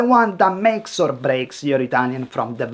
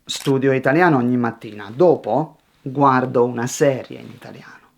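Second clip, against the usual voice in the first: complete each idea, pause, then the next; steady, tidy sentences with a clean break; composed delivery without hesitation; one finished thought; 3 semitones lower, faster than usual. Shown as a demonstration. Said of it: "Finish one thought before starting the next".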